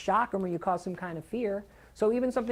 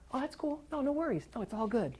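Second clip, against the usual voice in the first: higher, softer breezy reassurance; airy no-worries voice to a friend who disagrees; easygoing brush-off; 7 semitones higher, 8 dB quieter than usual. Shown as a demonstration. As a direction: let it slide, light and soft. Demoing walking away from arguments.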